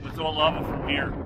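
ordinary talk

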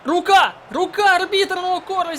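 sudden real outcry; handball appeal. Real, not acted. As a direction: yell for the handball call.

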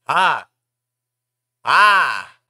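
loud shrill scream of fright; sudden loud scream; startled scream. Real, not acted.

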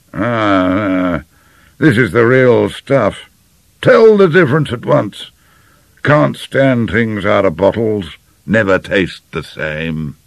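slow, emphatic General savouring his food; satisfied General. Acted. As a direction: pronounce approval like a bluff old General. General's character voice.

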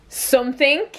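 ordinary talk, nothing marked

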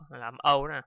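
ordinary talk, nothing marked

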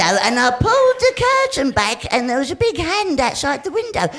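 ordinary talk, nothing marked

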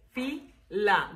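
"Fila" split into fi, la; syllable by syllable. Shown as a demonstration. Teacher counting two syllables, "Fila" broken fi... la. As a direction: pause between fi and la in "Fila". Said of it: "dos sílabas".

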